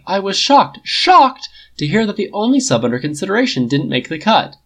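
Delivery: high, sarcastic, far voice; sarcastic outrage at the snub; mock shock. A narrator's reading. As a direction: play up fake shock.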